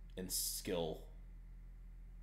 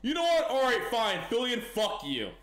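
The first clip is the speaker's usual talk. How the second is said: angry; 9 semitones higher, 5 dB louder than usual. Acted. Heated mock argument at Fillion.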